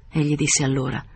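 usual speaking voice

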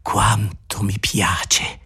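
slow, soft, breathed out with a long sigh; a slow whispered sigh of delight; breathy sigh. Acted. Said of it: "mettendo un lungo respiro".

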